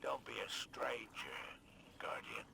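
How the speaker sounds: slow, hushed parting words; slow whisper. Acted. Spider bids the Guardian farewell in a slow whisper. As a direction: whisper it slowly as a quiet promise.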